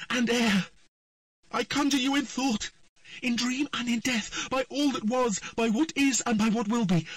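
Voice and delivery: high, loud, forceful spell; commanding incantation. A narrator's reading. Triss conjures the voice to reveal itself, high and loud. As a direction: cast it with all your power.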